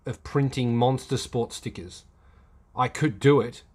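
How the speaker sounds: usual speaking voice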